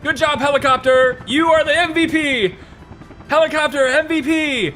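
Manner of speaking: high, jubilant cheer; elated praise. Real, not acted. Player praising helicopter that saved the building, elated. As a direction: hail the hero of the moment.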